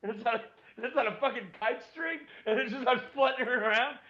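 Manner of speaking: high, fast, tumbling words; giddy excitement. Real, not acted. Picturing the leashed bat fluttering, high and fast. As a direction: race through it, thrilled.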